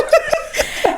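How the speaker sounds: amused high laugh. Real, not acted. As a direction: giggle up high. Laughs at the joke.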